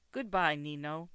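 ordinary delivery, nothing marked

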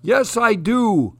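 defiant cry. A narrator's reading. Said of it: "cried Moose".

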